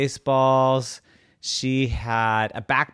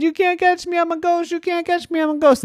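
very high singsong tease; taunting brother; 6 dB louder, faster than usual. A narrator's reading. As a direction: chant a high-pitched chase taunt. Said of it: "He said".

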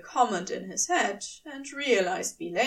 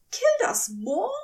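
higher, agitated angel pleading; upset angel voice; 10 semitones higher than usual. A narrator's reading. Aziraphale argues with Crowley, high and upset. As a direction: argue with rising distress. Angel's raised voice, angry argument.

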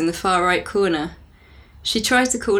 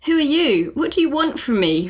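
panicked; 6 semitones higher than usual. Acted. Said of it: "replied Nicole, panicked".

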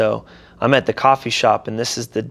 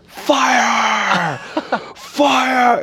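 high, drawn-out mock scream; imitated scream; 13 semitones higher than usual. Teller mimics Hannah screaming in Walmart, high mock scream. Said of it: "starts screaming the word".

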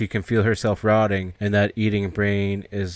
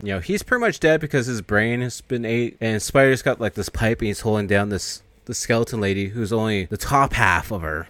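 normal talking voice

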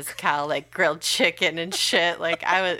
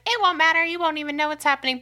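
high, louder mocking of the advice; mock reassurance; 12 semitones higher, 5 dB louder, faster than usual. Mother mocking what everybody told her, high and louder. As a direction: parrot everyone's advice in a higher voice. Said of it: "everybody's like".

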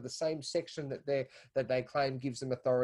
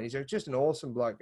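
ordinary delivery

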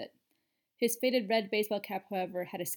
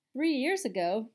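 taken aback; 4 semitones higher, 6 dB louder, slower than usual. A narrator's reading. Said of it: "Daphne was taken aback".

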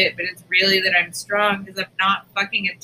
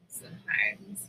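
a soft unvoiced whisper, lower; 11 semitones lower, 12 dB quieter, slower than usual. Real, not acted. Telling her friend crying is good, a whisper. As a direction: let it fade into a whisper. Trailing confession.

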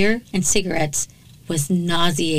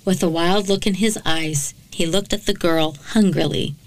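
ordinary delivery

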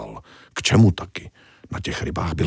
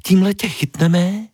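much higher cartoon fish voice; little fish voice; 13 semitones higher than usual. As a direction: play the tiny helpful fish up high. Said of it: "doplnila malá".

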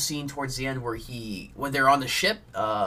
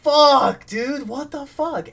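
high, loud, slow disbelieving cry; much higher shocked yell; retold shocked outburst; 14 semitones higher, 8 dB louder, much slower than usual. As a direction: blurt it out in stunned disbelief. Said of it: "And I was like".

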